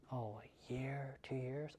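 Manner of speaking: soft, slow hesitant answer; quiet unsure seller. Acted. Seller quietly answers the agent, soft and slow. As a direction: hold back, unsure how long.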